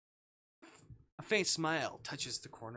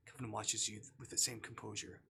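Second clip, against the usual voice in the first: ordinary talk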